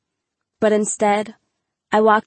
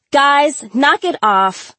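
loud, raised-pitch teacher's warning; 7 semitones higher, 5 dB louder, slower than usual. A narrator's reading. Teacher to a class erupting in laughter, warning. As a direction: call the class to order firmly. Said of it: "Miss Valenti warned".

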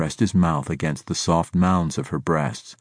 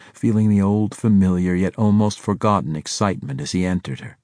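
usual speaking voice

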